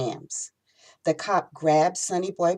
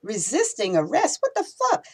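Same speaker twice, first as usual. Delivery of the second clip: high, shocked disbelief; incredulous; 7 semitones higher than usual. Acted. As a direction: throw it back in outrage. Stunned by false charge.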